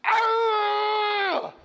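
long, high screaming baboon roar; loud, drawn-out animal shriek, imitated; baboon yell. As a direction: bellow like an enraged alpha baboon. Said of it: "he's yelling at me".

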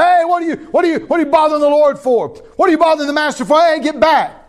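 high loud scolding; rebuking crowd. Acted. Preacher acting the crowd telling the beggar off, loud scolding. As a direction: shoo him away from the Lord.

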